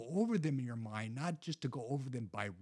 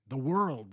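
world pressed louder with a lift; emphasize world as the key word; stress on world; 3 semitones higher, 8 dB louder than usual. Shown as a demonstration. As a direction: hit the word world to make it stand out. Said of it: "when you emphasize different words of a verse".

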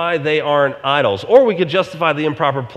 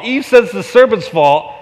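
ordinary delivery

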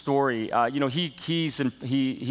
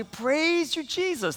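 high, rising cry of praise; excited praise; 12 semitones higher, much slower than usual. Retold or imitated. Grandparents cheering a student with excited praise. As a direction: lift it up to Jesus with joy. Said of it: "getting more excited about it and say".

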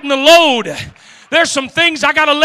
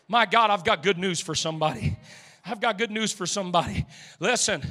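softer, gentler preaching, close to the people; quiet good news; 3 semitones lower, 9 dB quieter than usual. Acted. Preacher softly reassuring the congregation, gentle good news.

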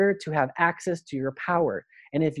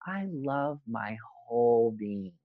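much softer and slow, heartfelt; sincere self-love; 4 semitones lower, 8 dB quieter, slower than usual. Shown as a demonstration. A guide showing true self-love, soft and slow. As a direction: say I love my whole being gently, as a quiet truth. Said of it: "in the way of that ego statement versus".

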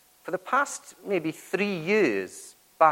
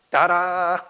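louder, playful showman's flourish; mock dramatic ta-da; 4 semitones higher, 7 dB louder than usual. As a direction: announce yourself like a mock magician. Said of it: "make maybe a dramatic entrance".

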